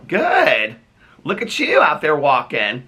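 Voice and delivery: high-pitched neighborly chat; calling to a passer-by. Real, not acted. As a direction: pitch it up to greet someone outside. Chats with someone outside.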